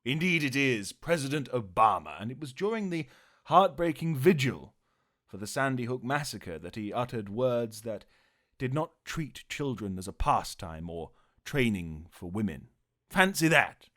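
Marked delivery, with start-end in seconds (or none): none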